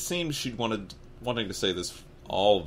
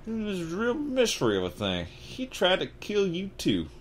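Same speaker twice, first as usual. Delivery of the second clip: pained; 11 semitones higher, slower than usual. Acted. Hagrid reaches the mystery, moved.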